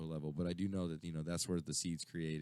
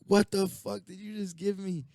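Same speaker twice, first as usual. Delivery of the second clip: higher, louder freaked-out demand; panicked yelling; 8 dB louder than usual. Retold or imitated. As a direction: yell it in shock at the friend. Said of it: "He's like".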